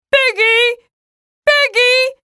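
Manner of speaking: high excited calls of a name; calling out joyfully. Acted. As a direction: shout for a friend happily. Calling friend in joy.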